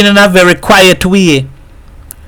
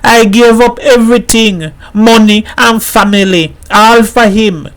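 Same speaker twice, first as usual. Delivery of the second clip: high, pleading lament; crying; 9 semitones higher than usual. A narrator's reading. Missus Herrera weeps to the mother, high and pleading. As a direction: plead through tears. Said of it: "broke down and cried like a girl".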